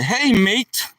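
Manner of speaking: put-on high, rough stab at an Australian accent. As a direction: greet like a badly imitated Australian. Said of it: "I can't mimic his accent too well".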